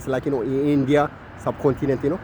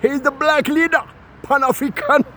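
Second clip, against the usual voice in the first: mock admirers; 5 semitones higher than usual. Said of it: "they say".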